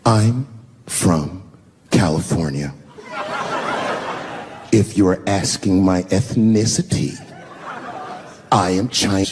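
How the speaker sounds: low, drawn-out, condescending reply; imitated haughty woman, slow and low. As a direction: talk down to him, word by word. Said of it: "very condescendingly, she says".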